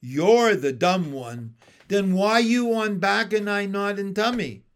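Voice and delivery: taunting frog. Acted.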